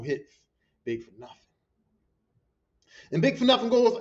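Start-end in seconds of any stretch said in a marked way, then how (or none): none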